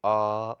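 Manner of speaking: "आ" held for two beats, loud; long vowel. Shown as a demonstration. Teacher contrasting three vowel lengths, long vowel. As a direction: lengthen "आ" moderately and push it out. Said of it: "दीर्घ है".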